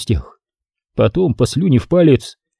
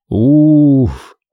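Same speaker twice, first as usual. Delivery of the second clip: relieved sigh; 3 dB louder than usual. A narrator's reading. Relief after fear.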